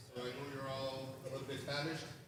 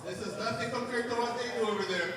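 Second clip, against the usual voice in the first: high, loud event announcing; loud MC shout-out; 7 semitones higher, 6 dB louder than usual. Real, not acted. MC telling guests about refreshments and sponsors, loud announcing. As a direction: project shout-outs across a busy room. MC calls out to the room.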